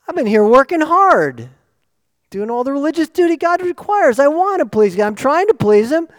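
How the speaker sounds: hammed-up indignant churchgoer; mock self-righteous Pharisee. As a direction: play the offended religious insider. Voicing indignant religious people.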